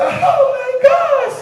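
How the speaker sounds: high, loud, slow gasp of shock; shocked outburst. Acted. Teacher hearing a very long name, shocked high cry. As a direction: recoil at the name.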